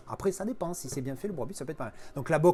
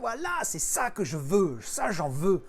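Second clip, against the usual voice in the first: delighted; 7 semitones higher, slower than usual. Real, not acted. Delighted at the lenticular.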